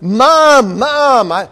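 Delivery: put-on high, eager child calling out to mom; a child bursting in with news, stammering. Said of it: "you came running in and you said".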